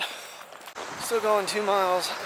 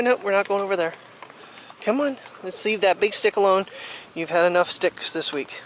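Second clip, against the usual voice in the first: louder, firm no-nonsense scolding of the dog; 6 dB louder than usual. Real, not acted. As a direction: tell the dog off firmly. Scolding dog about the stick.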